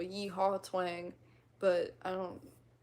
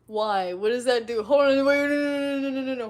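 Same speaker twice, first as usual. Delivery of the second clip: loud, higher outburst of objection; flustered protest; 4 semitones higher, 11 dB louder than usual. Real, not acted. Streamer objecting to chat's teasing, flustered and loud. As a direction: protest over them with a rapid string of refusals.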